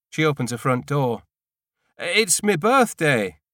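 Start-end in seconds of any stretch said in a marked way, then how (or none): 2.0-3.4 slow call across the street, higher and louder; shouting across the road to the neighbour. A narrator's reading. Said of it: "I shout".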